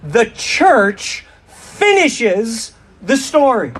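slow, drawn-out unison phrase; slow call and response. Shown as a demonstration. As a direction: lead the crowd, very slowly. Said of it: "Let's say that together".

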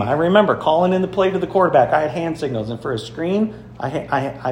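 ordinary delivery

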